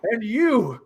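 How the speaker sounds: high, accusing villain rant. Acted. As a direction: spit blame at the brute. GM voices halfling villain.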